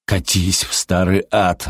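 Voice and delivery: slow, restrained angry retort; understated growl. A narrator's reading. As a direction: growl it without raising the voice. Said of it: "рявкнул Фрэнк". (сдержанно рявкнуть, медленно)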